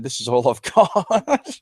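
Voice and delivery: slow quote breaking into a laugh; laughing retelling. Retold or imitated. Kid offering a mugger his cash, retold while laughing. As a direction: let laughter break through the retold plea.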